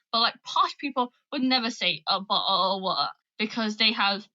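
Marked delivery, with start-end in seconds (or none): 2.1-3.1 "bottle of water" as Cockneys say it, without t's; dropped letters. Shown as a demonstration. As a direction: glottal stops replacing the t's in "bottle" and "water". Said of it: "Cockney people would".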